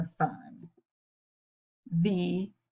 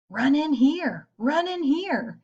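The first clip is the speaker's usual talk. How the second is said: higher sly fox calling out; mock scream; 6 semitones higher than usual. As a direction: call them in eagerly, pitched up. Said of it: "he screamed".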